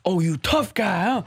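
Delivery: high taunting yell; mocking scream. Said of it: "He's, like, screaming".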